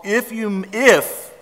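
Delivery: "if" punched high and loud; stress on if. Shown as a demonstration. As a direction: lean hard on the word "if". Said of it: "we miss that word all the time".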